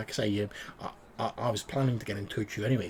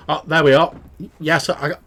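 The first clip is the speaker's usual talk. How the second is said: louder, higher, sudden on speaker; speakerphone loud; 4 semitones higher, 7 dB louder than usual. Acted. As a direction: come through loud and clear. NPC on speaker now.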